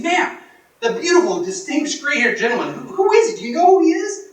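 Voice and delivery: dying to know who he was; eager excitement. Retold or imitated. Couple asking the waitress about the gentleman, high and eager.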